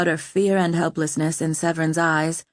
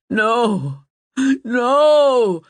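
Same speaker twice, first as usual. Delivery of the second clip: rising, louder desperate protest; groaning terror; 5 semitones higher, 5 dB louder than usual. A narrator's reading. King begging as the birds close in, terrified. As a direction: plead against what is coming. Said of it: "the king groaned in terror".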